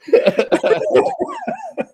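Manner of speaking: high-pitched burst of laughter; long high laugh; real laugh. Real, not acted. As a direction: crack up at your own joke. Host laughs at own teasing.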